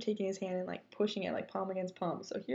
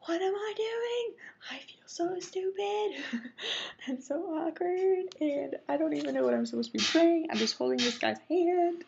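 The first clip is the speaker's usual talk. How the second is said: awkward inner panic; 10 semitones higher, 3 dB louder than usual. Retold or imitated. Praying while holding a guy's hand, awkward high-pitched self-talk. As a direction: replay your mortified inner monologue, pitched high. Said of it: "I'm just thinking to myself".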